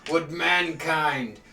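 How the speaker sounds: booming, furious giant preaching; fire giant voice. Acted. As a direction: roar it like an angry giant. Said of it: "You hear the fire giant's voice".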